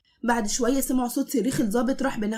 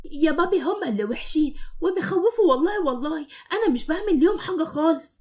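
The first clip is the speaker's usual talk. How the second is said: put-on higher, innocent child's voice; innocent little boy; 5 semitones higher than usual. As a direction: plead innocence to daddy. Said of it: "بكل براءة". (ولد صغير بيتكلم ببراءة)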